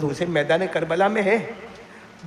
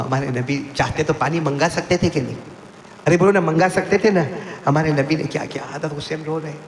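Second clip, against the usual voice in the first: normal talking voice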